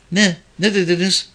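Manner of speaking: put-on high young girl's voice; distracted girl. A narrator's reading. Girl missed the policewoman's question, high young voice.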